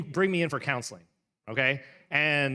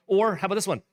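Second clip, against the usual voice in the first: usual speaking voice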